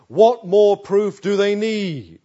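loud and slow rhetorical question; slow, loud, incredulous; emphatic demand. Real, not acted. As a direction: hammer the question home.

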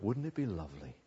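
low, soft, dreamy wondering; wistful aside. Acted. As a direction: murmur it low like a private wish. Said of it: "Oh, says Job".

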